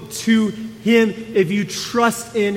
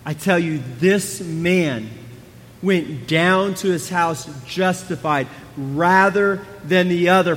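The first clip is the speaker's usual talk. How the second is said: ordinary delivery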